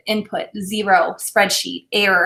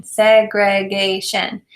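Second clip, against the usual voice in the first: "Segregation" slowly, each syllable tapped apart: seg-re-ga-tion; 3 dB louder, much slower than usual. Shown as a demonstration. Teacher modeling syllable tapping for students, slow and separated. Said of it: "Tap and say the syllables in segregation".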